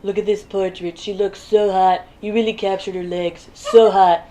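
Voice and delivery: hint of a president impression, admiring the portrait; Trump. President admires his daughter's portrait, lightly mimicked voice. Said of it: "if Trump called a woman hot".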